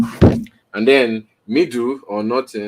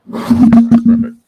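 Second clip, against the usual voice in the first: excited outburst; 9 dB louder, much slower than usual. Real, not acted. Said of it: "Sorry about that".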